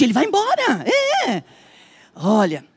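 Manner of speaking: ordinary talk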